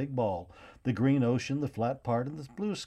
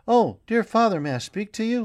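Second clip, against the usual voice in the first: put-on eager child; 11 semitones higher, 8 dB louder than usual. Boy rushes to his father.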